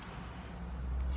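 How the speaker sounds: long breathy sleepy sigh; tired sigh. Real, not acted. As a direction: let out a weary sigh. Tired sigh before yawn.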